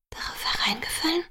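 barely audible murmur. A narrator's reading. Girlfriend questions being tricked, barely murmuring. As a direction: murmur it as a small, rising question. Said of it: "murmelte ich fast unhörbar".

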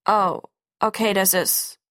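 halting, taken-aback father; lost for words. A narrator's reading. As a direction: grope for the next word and break off. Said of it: "er rang um Worte". (nach Worten ringend)

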